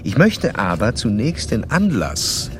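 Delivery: measured narrator reading a clinical report; formal case narration. Acted. Doctor narrating his case study to the listener in a formal reading voice. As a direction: recount the case like reading from your own notes.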